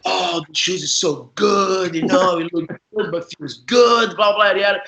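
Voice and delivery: imitating a slow, showy brag about new shoes; mock the show-off, drawing the words out; bragging teammate. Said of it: "he was bragging about his shoes".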